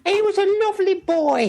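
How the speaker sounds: very high, giggly put-on voice; silly falsetto. Co-host joins in praising the guest, silly falsetto. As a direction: squeal it in mock falsetto.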